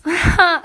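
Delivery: loud, high burst of laughter; one quick burst of a laugh; sudden laugh. Real, not acted. Woman finds her thread and lightens up, sudden laugh.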